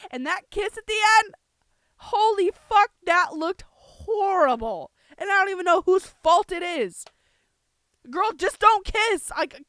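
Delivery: loud, high, appalled outburst; exasperated yelling; aggressive rant. Real, not acted. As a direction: rail at it in disbelief. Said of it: "I did sound very aggressive".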